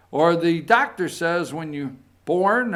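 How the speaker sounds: ordinary talk, nothing marked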